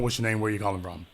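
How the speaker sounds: normal talking voice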